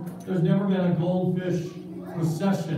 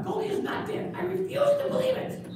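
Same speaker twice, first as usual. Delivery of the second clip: high, breathy refusal; a boy who won't accept it; high puppet boy in mock denial; denying puppet kid.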